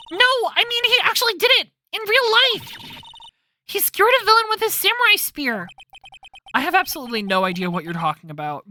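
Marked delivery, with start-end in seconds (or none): 0.1-5.7 much higher, loud insistence; frantic Maya. Acted. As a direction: make him believe it.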